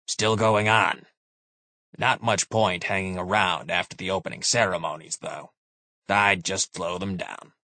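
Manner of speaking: lightly voiced calm old soldier; a hint of a weary officer; grizzled veteran. Moore voiced in narration.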